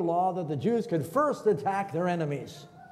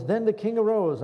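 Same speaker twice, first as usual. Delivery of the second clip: higher, louder proclamation; louder, higher dramatic reading of the verse; 6 semitones higher, 4 dB louder than usual. Acted. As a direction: announce the turning point.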